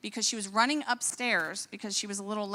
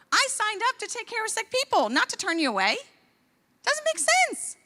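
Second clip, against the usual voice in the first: outraged; 9 semitones higher than usual. Real, not acted. Doctor's outraged rant.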